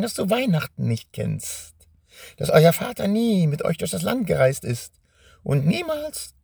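high, gloating witch voice. Acted. Witch taunts Benjamin. (hohe, überhebliche Hexenstimme)

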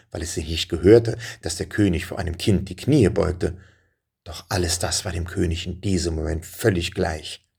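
usual speaking voice

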